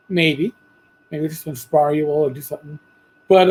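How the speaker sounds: ordinary talk, nothing marked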